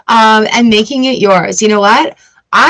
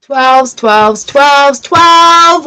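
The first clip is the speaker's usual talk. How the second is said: louder, rising calls of "twelves"; yelling twelve; 5 semitones higher, 4 dB louder, much slower than usual. Real, not acted. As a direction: call the number out like a summons. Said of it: "Why am I yelling twelve".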